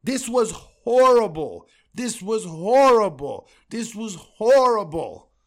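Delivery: slow, loud, hammered verdict, three times; repeated yell. Real, not acted. Fan host hammering his team with loud slow yells. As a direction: yell it again and again, like a chant.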